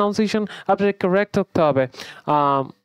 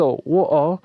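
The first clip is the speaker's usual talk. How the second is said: British glottal "wo-o", t dropped; British accent. Shown as a demonstration. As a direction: drop the t in water, British style. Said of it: "British অ্যাকসেন্টে ওয়াটার এবং ও". (British উচ্চারণে ট ছাড়া "ওয়-অ")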